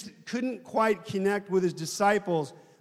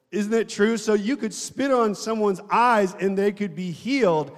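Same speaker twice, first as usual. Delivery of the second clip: normal talking voice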